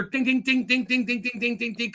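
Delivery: high, light ding ding ding; ping like sealing jars; imitated jar pings. Host imitates jars pinging, high light dings.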